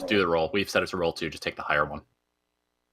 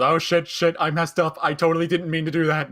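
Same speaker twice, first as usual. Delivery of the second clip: loud, higher-pitched fake alarm; feigned panic; 7 semitones higher, 4 dB louder than usual. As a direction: blurt out a panicked, guilty excuse. Feigned panic after starting fire.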